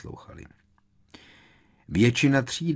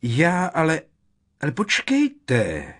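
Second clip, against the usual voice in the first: loud, high, urgent protest; sudden cry; 10 semitones higher, 8 dB louder, slower than usual. A narrator's reading. Seer suddenly stopping the inspector, crying out. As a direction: cry out to stop her. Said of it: "vykřikla náhle".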